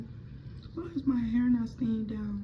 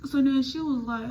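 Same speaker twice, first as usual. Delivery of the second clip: ordinary delivery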